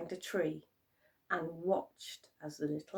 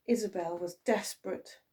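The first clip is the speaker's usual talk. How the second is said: plain reading voice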